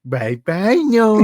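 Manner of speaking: high singsong goodbye; teasing farewell. Pretend. Waving off arrested co-host, high teasing farewell. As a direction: call it out in a mocking lilt. Mock farewell while driving away.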